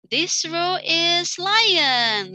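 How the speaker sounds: very slow, high, loud announcement; teacher assigning groups. Shown as a demonstration. Teacher naming the row's group animal, slow and loud. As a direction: announce it like a game. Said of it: "classroom instructions demo".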